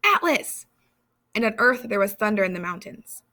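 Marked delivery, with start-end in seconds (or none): 0.0-0.7 a second loud, high call; calling out to the sky; yell. A narrator's reading. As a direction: shout the name again, still loud. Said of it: "he yelled".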